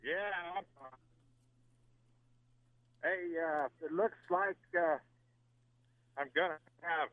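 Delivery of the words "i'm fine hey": ordinary delivery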